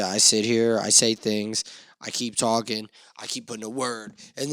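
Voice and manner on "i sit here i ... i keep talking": ordinary delivery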